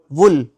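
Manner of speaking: वुल with a short 'u', as in 'pull'; short-vowelled वुल, one quick syllable; correct pronunciation. Shown as a demonstration. Teacher modelling the word for wool, correct pronunciation. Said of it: "वुल नहीं".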